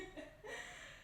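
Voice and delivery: quick airy giggle; soft breathy laugh. Real, not acted. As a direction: let a soft laugh slip out. Laughs after glasses steam up.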